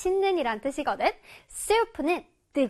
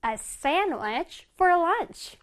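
very slow, words drawn out; slow model reading; much slower than usual. Shown as a demonstration. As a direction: read slowly for the class to copy.

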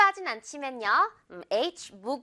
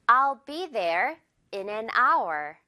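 very slow, careful I'll be there in an hour; slow model sentence; much slower than usual. Shown as a demonstration. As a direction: read it slowly for learners. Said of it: "hour 예문 볼까요?". (천천히 또박또박 예문 읽기)

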